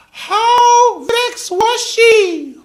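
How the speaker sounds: very high, loud, very slow put-on voice; hammed-up call-and-response; comic character voice. Shown as a demonstration. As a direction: stretch the question in a silly voice. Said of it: "all my voices sound like Stewart".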